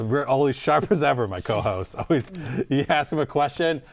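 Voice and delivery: ordinary delivery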